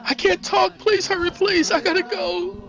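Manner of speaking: very high, urgent woman's voice; frightened pleading. Acted. Woman begging police to hurry before she hangs up, frightened. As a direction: plead to get off the line.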